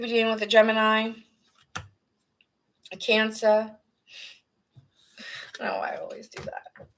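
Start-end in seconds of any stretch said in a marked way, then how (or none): 5.6-6.9 a real whisper, under the breath; whispered aside. Real, not acted. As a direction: whisper it to yourself, half aside. Tarot reader mutters to herself.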